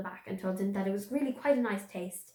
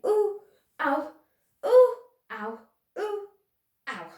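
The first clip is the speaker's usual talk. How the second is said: high, loud yelps up and down; ooh ow cries; 10 semitones higher, 7 dB louder, slower than usual. A narrator's reading. Piglet bouncing in the pocket, high yelps. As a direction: yelp with every bounce. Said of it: "as he was saying".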